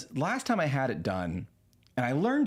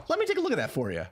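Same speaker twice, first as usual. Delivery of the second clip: chirpy AI voice; 8 semitones higher, faster than usual. Retold or imitated. AI receptionist voiced.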